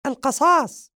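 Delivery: very high, loud squeaky cartoon cat. The cat prompting the vampire, high squeaky cat. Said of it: "وهو يقول بصوت خافت".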